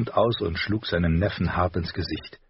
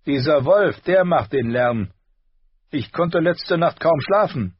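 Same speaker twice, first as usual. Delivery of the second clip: higher, louder voice for a spoiled prince; bored prince; 9 semitones higher, 6 dB louder than usual. A narrator's reading. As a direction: sneer it like a spoiled boy. Prince complains.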